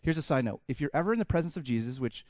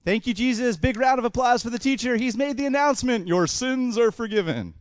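loud, higher-pitched hype-man announcement; big sarcastic emcee cheer; mock announcer; 6 semitones higher, 5 dB louder than usual. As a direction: whip up applause for the teacher, stretching the last words. Mock applause announcement of forgiveness.

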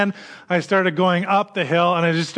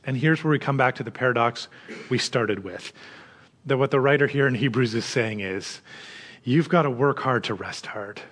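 normal talking voice

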